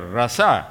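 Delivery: "rasa" stressed on the last syllable; Hebrew pronunciation. Shown as a demonstration. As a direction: say "rasa" with the accent at the end. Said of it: "the accent is at the last letter".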